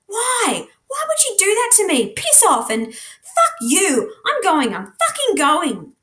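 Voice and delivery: high, cracking, near-tears outburst; voice cracking in anger. A narrator's reading. Charlie shouting at Jasper, betrayed, voice cracking. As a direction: lash out, voice breaking high. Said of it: "My voice cracks and breaks".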